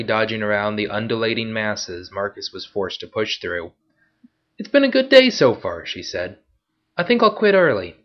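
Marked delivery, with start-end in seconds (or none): 4.6-5.8 much higher, playful female voice; teasing woman. Acted. Pickpocket woman teases. 7.0-8.0 much higher, playful female voice; teasing woman. Acted. Pickpocket woman.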